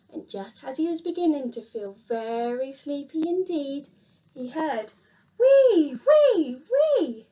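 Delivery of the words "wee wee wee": loud, high whistling snore; squeaky snore. A narrator's reading. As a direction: whistle out a snoring wee. Snoring noise in story.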